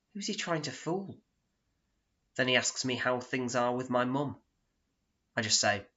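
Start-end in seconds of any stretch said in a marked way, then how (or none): none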